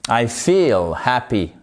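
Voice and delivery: "feel" with a slow, stretched ee; long I. Shown as a demonstration. Teacher models the correct sentence, long I in "feel". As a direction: draw out the ee in "feel", low and falling. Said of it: "Voyez la différence?".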